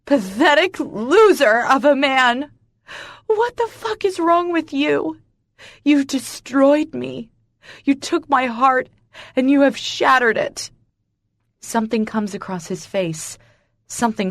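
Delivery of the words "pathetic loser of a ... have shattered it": higher, louder anguished shout; woman accusing the man who shattered her heart; voice cracking. A narrator's reading. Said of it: "my voice cracks".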